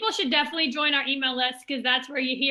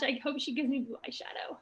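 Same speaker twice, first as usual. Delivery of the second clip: whisper; 9 dB quieter, faster than usual. Real, not acted. Giddy aside about makeup.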